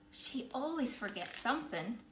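usual speaking voice

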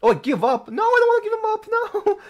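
loud, very high alarm; panicked outcry. Real, not acted. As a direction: cry out as if you pressed the wrong thing. Accidental give-up in the game.

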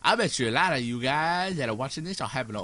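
ordinary talk, nothing marked

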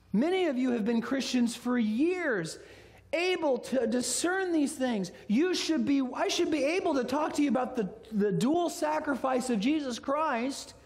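high, forceful, insistent sermon delivery; impassioned pulpit exhortation; preach. Real, not acted. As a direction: preach it hard, stretching the key phrases.

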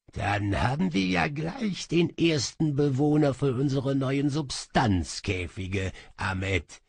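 gloating magician, lightly voiced; smug sorcerer. Sorcerer gloats to his servant, smugly. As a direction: savour the captured prize.

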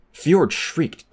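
ordinary delivery

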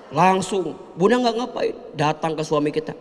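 normal talking voice

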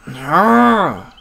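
high, loud cry of frustration; long exasperated "ah" yell; frustrated yell. Acted. Cries out in frustration.

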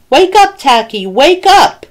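high, urgent calling; urgent wake-up call. A narrator's reading. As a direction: call out to rouse a sleeper. Penguins urgently waking sleeping Tacky.

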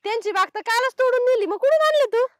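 वरच्या आवाजात अविश्वासाने ओरड; खोटं पकडल्याच्या रागात चढवून विचार; रागीट जाब. Acted. खोटेपणा पकडते, ओरडते. (चिक्कू चोरणाऱ्या मुलाला जाब विचारणारी मालकीण, चढ्या रागीट आवाजात)

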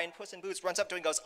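plain reading voice

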